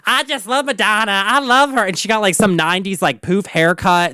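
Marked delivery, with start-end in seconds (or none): 0.1-1.8 high-pitched gushing imitation; mock starstruck fangirl, pitched up; gushing fan. Childhood friend gushing about [name], imitated in a high voice. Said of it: "And she's like".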